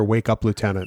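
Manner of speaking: usual speaking voice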